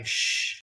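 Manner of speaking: a voiceless, whispered shush; quick hissed shh like a tennis crowd. Retold or imitated. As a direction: hush the crowd with a breathy shh. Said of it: "The other half is yelling".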